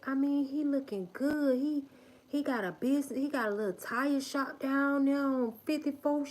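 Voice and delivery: high, excited gossip voice; gossiping acquaintance. Retold or imitated. Gossiper telling family members how good he looks. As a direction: gush about Craig like juicy news. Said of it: "running to them and like".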